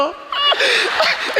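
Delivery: a high laugh that tips into tears; laughing cry. Real, not acted. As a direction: laugh till it becomes a sob. Laughs and nearly cries.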